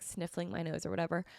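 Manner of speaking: usual speaking voice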